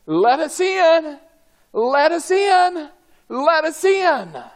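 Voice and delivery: high, loud, drawn-out cries; desperate pleading. Acted. Crowd beats on the ark's door, desperate shouting. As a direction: cry out to be let through the door.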